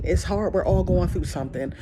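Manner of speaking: ordinary talk, nothing marked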